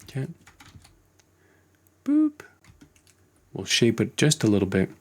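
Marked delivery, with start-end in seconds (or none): 2.0-2.4 high playful boop. Pretend. Blink sound while keying eyelids.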